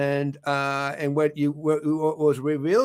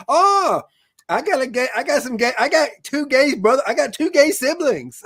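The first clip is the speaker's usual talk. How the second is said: high, loud, tumbling over the words; imitating an eager, high-pitched outburst; excited retold reaction; 10 semitones higher, 6 dB louder than usual. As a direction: blurt it out, stumbling and restarting in excitement.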